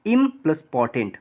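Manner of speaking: ordinary delivery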